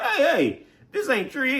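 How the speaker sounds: a student objects that calculus is not trig; mock protesting student. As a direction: squeak out the complaint like a thrown student.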